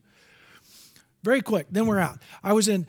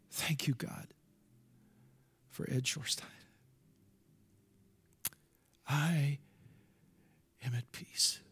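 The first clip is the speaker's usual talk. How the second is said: very soft, low, halting thanks to God; hushed prayer; 6 semitones lower, 11 dB quieter than usual. Retold or imitated. Preacher recalls his third thought as the tornado hit, hushed prayer. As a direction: let each phrase sink into a low hush. Retold prayer while facing death.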